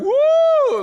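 high, loud joyful woo; excited whoop. Retold or imitated. Pastor imitates a congregation whooping with joy. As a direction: whoop like a happy crowd. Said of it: "people were like".

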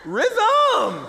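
high, loud laughing cheer; laughing rhythm cheer. Retold or imitated. Pastor acts out joyful people cheering rhythm. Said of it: "people were like".

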